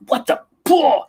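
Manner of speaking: high, sudden mock rage blurt; mock outburst. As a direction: act out a triggered flare-up. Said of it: "You're so mad".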